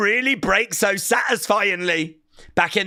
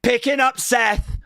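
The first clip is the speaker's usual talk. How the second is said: excited play-by-play; 6 semitones higher than usual. Real, not acted. Live call of a near-fall.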